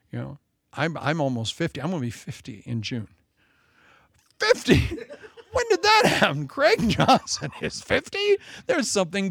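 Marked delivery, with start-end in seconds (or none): 4.4-9.3 much higher, laughing disbelief; mock shock. Pastor turning fifty, mock shocked squeal. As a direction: squeal in pretend horror at his age.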